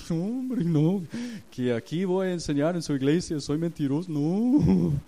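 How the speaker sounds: high, loud pleading refusal; mock distressed protest. Pastor arguing with the Holy Spirit over confessing, mock-distressed high protest.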